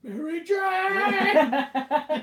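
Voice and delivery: very slow, high, loud laughing wail; mock anguished wail. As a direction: wail her name melodramatically. Spider-Man crying out for MJ.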